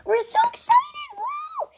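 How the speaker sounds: excited cheer. Acted. Party guests cheer together.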